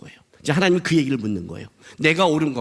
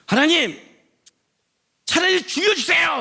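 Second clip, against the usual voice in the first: high-pitched, strained protest cry; outraged plea; 12 semitones higher than usual. Acted. Jonah demands that God let him die, outraged plea. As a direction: cry out in exasperated despair.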